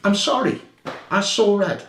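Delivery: reliving it and getting worked up again; real anger rising. Real, not acted. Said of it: "I was so angry".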